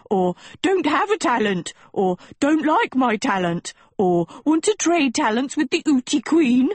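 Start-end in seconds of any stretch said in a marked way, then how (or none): 0.6-1.7 high, whiny mock student voice; mock a whiny kid, much higher. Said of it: "Vex whining". 2.4-3.7 higher, whiny mock student voice. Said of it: "Vex whining". 4.4-6.7 mock a whining kid, much higher; whiny student. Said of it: "Vex whining".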